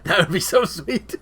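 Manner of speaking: laughing while talking. Real, not acted. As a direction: giggle through the words. Laughing at the idea.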